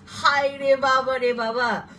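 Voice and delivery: drawn-out high dismayed cry; slow loud mock-despairing oh my god; exasperated exclaim. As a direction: wail it slowly like it was a nightmare. Recalling a bad bus journey.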